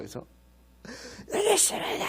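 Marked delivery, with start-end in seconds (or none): 1.3-2.1 shouted reply. Retold or imitated. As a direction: yell it up the slope. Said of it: "소리 질렀지".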